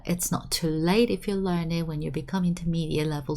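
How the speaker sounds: usual speaking voice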